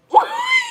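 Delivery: high laughing shriek. Real, not acted. Reader cracks up at her own deck joke, high shrieking laugh. Joking about a new deck.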